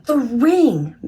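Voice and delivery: almost scream it in relief; near scream. A narrator's reading. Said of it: "Maria nearly screamed".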